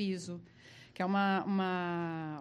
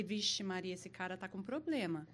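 nervous aside; 2 semitones lower, 8 dB quieter than usual. Retold or imitated. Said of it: "Eu falei".

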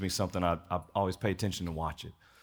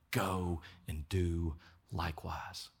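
softer and slow, gently weighted; hushed quote; 4 semitones lower, 9 dB quieter, slower than usual. Acted. Preacher echoes Christ's words into the ear, softer and slow. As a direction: let each word land softly.

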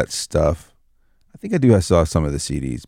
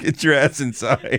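put-on calling kids to dinner; 7 semitones higher than usual. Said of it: "you just open the cardboard door".

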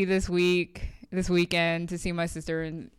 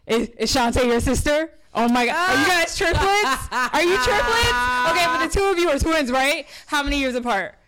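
higher, excited gushing questions; gushing church lady; 6 semitones higher, 4 dB louder than usual. Retold or imitated. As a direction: gush over the look-alike sisters. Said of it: "it's like".

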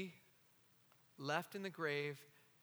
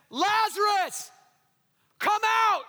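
higher, louder, slow ringing call to the dead; loud cry; 14 dB louder, slower than usual. Acted. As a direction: call him out of the tomb with full force. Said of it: "Jesus cries out in a loud voice".

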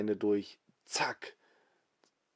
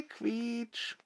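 braking squeak; 11 semitones higher, 9 dB quieter than usual. Acted. Bus brakes screech.

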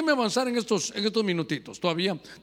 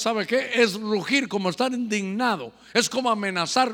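ordinary talk, nothing marked

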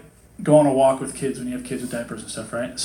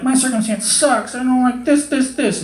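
whiny complaint; 9 semitones higher than usual. Retold or imitated. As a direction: moan about your lot to God. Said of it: "if you're like".

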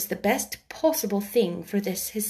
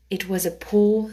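ordinary delivery

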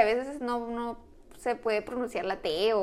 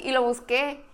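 normal talking voice

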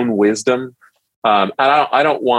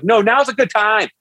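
high, fast and cheery; bubbly mother answering the door, quick; chirpy mom voice; 10 semitones higher than usual. Retold or imitated. Mother-in-law greets nervous suitor, bright and high.

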